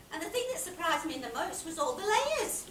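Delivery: loud, high, swelling amazement; amazed wonder. Acted. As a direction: let the wonder build and soar. Character marvels at her discovery.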